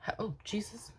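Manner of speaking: whispered, startled mutter. Real, not acted. Vlogger startled while starting her greeting, whispering. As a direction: caught off guard, breathe it out under the voice.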